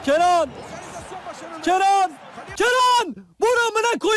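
high, urgent shouts to a player; excited calling out. Real, not acted. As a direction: urge the shot on, pitch climbing. Urging his player to shoot. (heyecanla, tiz sesle bağırarak)

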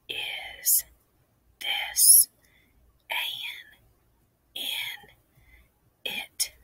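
soft unvoiced whisper. Shown as a demonstration. Teacher leads kids in a whispered word read. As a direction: whisper each word softly. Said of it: "let's read them soft in a whisper".

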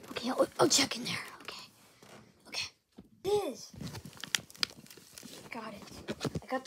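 quick hushed whisper. Acted. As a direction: whisper it fast, sneaking. Sneaking to check hiding spot.

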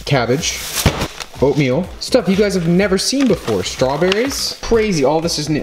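mock amazement, pitch swooping high; sarcastic. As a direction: hype up the ordinary as if it were thrilling, high-pitched. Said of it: "of course I'm being sarcastic".

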